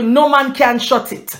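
usual speaking voice

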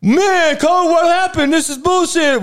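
hammed-up indignant player voice; outraged players. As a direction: play the players crying foul. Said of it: "all the players would be like".

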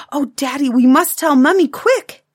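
high, loud, eager outburst; thrilled little girl urging her father; excited child. Acted. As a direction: burst out with thrilled urgency. Taffy thrilled, then dances round him.

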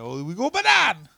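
put-on slow, high-pitched comic French accent; mock French accent. Comic obliging a request, in a mock French accent. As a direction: draw it out in a silly French accent. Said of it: "Can you do a French one?".

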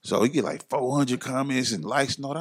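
normal talking voice

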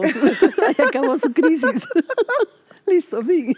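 laughing high and loud through the words. Real, not acted. Teacher laughing at her own anecdote, loud and high. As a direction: laugh it out as the story ends.

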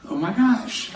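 shocked gasp. Retold or imitated. Panicked reaction at airport drug check.

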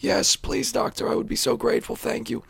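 very high, grateful comic rasp; raspy patient voice. Acted. Patient accepting surgery from his doctor, high raspy voice. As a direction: plead thanks in a high, raspy put-on voice. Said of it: "raspy voice and the third testicle".

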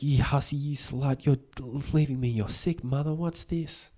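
hammed-up disapproving mother; mock scolding mother. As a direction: sneer at her like a nasty mum. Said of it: "her mom would be like".

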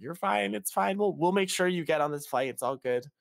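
high, sing-song imitation of staff; mock reassurance. Airline staff soothing nervous travellers, high mock reassurance. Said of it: "everyone's like".

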